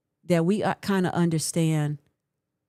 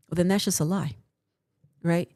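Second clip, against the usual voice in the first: normal talking voice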